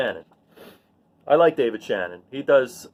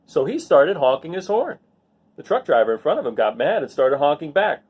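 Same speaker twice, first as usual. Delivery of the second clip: ordinary delivery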